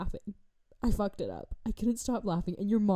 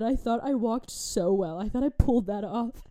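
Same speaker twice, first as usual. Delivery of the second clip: ordinary talk, nothing marked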